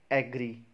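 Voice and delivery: "एग्री" starting with "ag"; AG-ree for "एग्री"; common mispronunciation. Shown as a demonstration. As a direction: say "एग्री" with an open "a" as in cat. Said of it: "इसको एग्री नहीं".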